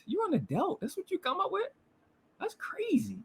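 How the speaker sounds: high, soft, incredulous voice; hushed disbelief. Real, not acted. Reacting to an adult's weak comeback, hushed disbelief. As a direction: drop to a high, airy near-whisper.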